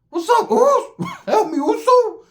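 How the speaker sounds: very high, loud squealed call; comic high-pitched shout; hammed-up holler. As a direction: whoop it out in a squeaky yell, laughing. Acts out hollering at the party.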